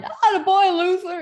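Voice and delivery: high, loud crackly heckler's cheer. Retold or imitated. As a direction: imitate a crackly-voiced shout from the crowd. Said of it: "this crackly voice".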